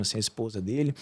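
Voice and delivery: normal talking voice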